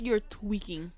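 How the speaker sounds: ordinary delivery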